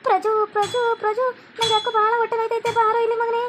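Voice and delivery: hammed-up groaning to pull him from his wife; faked stomach ache. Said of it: "ನಾನ್ ಈ ಥರ ನಾಟಕ ಮಾಡ್ತಿರೋದು".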